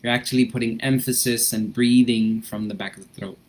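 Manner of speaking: gentle instructor easing the class into breathing; guided breathing. Acted. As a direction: calmly guide them through the breath.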